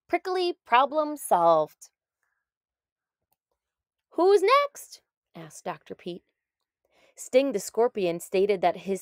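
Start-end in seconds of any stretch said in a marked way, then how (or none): 0.1-1.9 slow, emphatic catchphrase; storybook refrain. A narrator's reading. Narrator ending each case, slow triumphant refrain. 4.1-5.0 much higher, louder call for the next one; cheery call. A narrator's reading. Doctor calling the next patient, bright and high.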